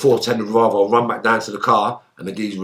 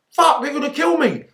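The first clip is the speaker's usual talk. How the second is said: panicked thought. Retold or imitated. Retold panic in fight.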